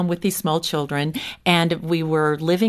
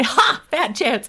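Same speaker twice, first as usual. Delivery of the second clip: slow, laughing mock husband; drawn-out scoffing dismissal, half laughing; husband's dry retort; 7 semitones higher, slower than usual. Husband to wife, leaving for work, slow scoff. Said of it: "And he would say".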